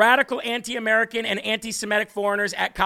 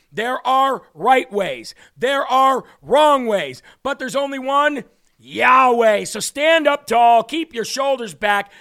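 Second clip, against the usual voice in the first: preaching; 5 dB louder than usual. Real, not acted. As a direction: rouse them like a preacher, stretched out. Closing preaching to viewers.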